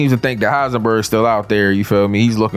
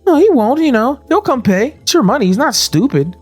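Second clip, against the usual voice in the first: high mocking voice; 12 semitones higher than usual. Voicing Walt threatening Jesse.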